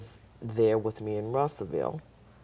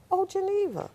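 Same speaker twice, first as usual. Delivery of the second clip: much higher, bright mock greeting; surprised doctor; 5 dB louder than usual. Doctor spotting nurse in the lobby, much higher mock greeting. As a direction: squeak out a friendly recognition.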